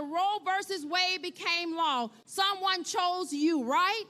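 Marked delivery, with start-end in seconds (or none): none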